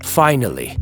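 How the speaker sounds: ordinary delivery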